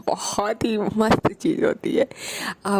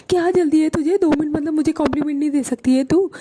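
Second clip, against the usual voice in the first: higher, fast, mock-offended whine; whiny demand; 7 semitones higher, faster than usual. [name] demands a compliment from hurried Bani, mock-offended whine. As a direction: pout for a compliment.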